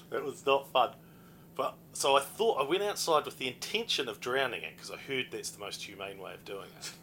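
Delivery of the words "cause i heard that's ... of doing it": usual speaking voice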